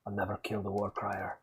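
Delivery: lightly voiced Irish accent, low and soft; a hint of an Irish lilt, said low; quiet mock Irish vow. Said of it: "Why is he Irish?".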